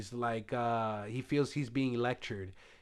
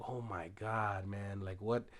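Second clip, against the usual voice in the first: dismayed murmur; 3 semitones lower, 6 dB quieter than usual. Retold or imitated. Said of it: "it's just like".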